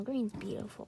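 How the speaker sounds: soft breathy whisper. Real, not acted. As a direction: whisper it close to the mic. ASMR-style soft comment.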